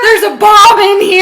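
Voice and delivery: very high, loud yell; mock panicked yell. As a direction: scream it like a kid alarming the whole plane. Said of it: "At least he didn't yell".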